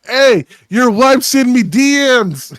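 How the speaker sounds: put-on high, loud, slow taunting Irish accent; cocky Irish fighter voice. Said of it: "He's like".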